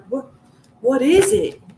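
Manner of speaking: eager, laughing what is it; laughing question. Retold or imitated.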